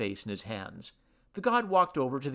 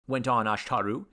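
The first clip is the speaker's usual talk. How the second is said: plain reading voice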